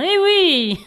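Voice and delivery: high laughing eh oui; triumphant laugh. Real, not acted. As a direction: laugh at your own punchline. Punchline of her own story.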